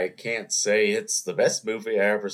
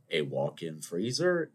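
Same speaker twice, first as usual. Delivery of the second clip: ordinary delivery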